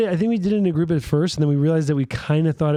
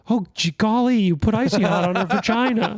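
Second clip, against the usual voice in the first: much higher, prissy gee-golly voice; mocking prim clinical guy; 7 semitones higher than usual. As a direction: mimic a prim, proper guy being polite about it. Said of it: "clinical guy".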